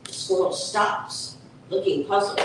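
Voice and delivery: ordinary delivery, nothing marked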